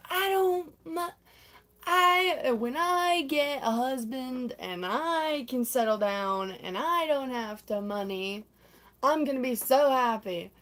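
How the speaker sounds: imitated socialite, high start then slow and drawn out; Tanya dreaming aloud. Tanya imagines settling down with a husband, mock dreamy. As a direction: draw it out as a mock wistful diva.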